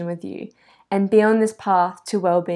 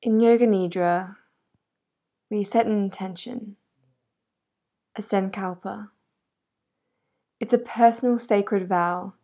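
calm, measured yoga nidra voice with long pauses; guided meditation. Real, not acted. Yoga guide helping a listener get comfortable, guided meditation. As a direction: settle the listener gently, leaving space between phrases.

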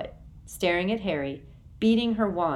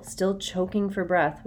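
ordinary delivery

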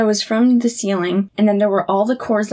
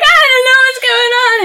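high, louder sing-song panic with laughter; retold panic; 14 semitones higher, 5 dB louder, faster than usual. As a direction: wail it to Dad half laughing. Retold panicking to Dad on phone.

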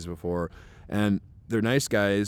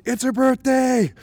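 loud, high, drawn-out shout; retold shout; 8 dB louder, slower than usual. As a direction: shout a slow, high announcement to the whole room. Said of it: "points to her and shouts out".

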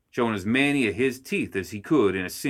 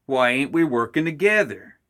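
exasperated outburst; 5 semitones higher, 5 dB louder than usual. Acted. Heated gremlin quarrel.

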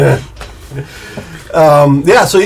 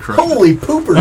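surprised; 6 semitones higher than usual. Real, not acted. Surprise at high roll.

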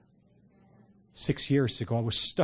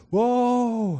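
long, slow "whoa" swooping much higher; drawn-out rapturous exclamation; ecstatic whoa; 10 semitones higher, 4 dB louder than usual. Real, not acted. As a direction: caught off guard by the Spirit. Overwhelmed by presence mid-sermon.

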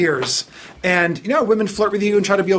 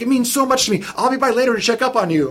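higher, gushing thanks; gushing manager; 7 semitones higher than usual. Retold or imitated. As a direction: pour out thanks, overly sweet. Voicing Gina again.

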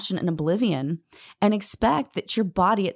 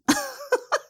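high laugh. Real, not acted. Laughs at son's remark.

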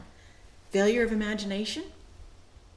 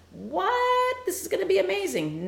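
mock excited brothers; 12 semitones higher than usual.

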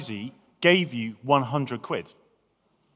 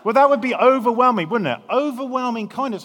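much higher and louder, marvelling; amazed emphasis; 8 semitones higher, 7 dB louder than usual. Real, not acted. Preacher marvelling to the congregation at undeserved kindness, loud and high. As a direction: burst out at the overwhelming kindness. Climax of the illustration.

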